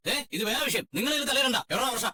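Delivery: high, curt dismissal; angry. Acted. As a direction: warn them off angrily. Brother quarrelling, brushing off interference. (ദേഷ്യത്തോടെ താക്കീത്)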